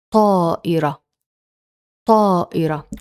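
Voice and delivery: طائرة said clearly, heavy emphatic ط; Arabic word demo. Shown as a demonstration. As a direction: model طائرة for students to repeat. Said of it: "Here are some examples".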